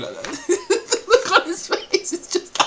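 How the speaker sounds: high, squeezed words lost in laughing; helpless laughter. Real, not acted. Host recalls the look on his face, laughing helplessly. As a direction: try to speak while cracking up. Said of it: "I died laughing".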